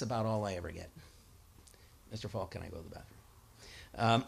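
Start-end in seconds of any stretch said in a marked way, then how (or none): 2.1-3.2 mock whiny student asking to leave, fast and soft. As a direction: play a bored kid begging to go. Student whining to teacher.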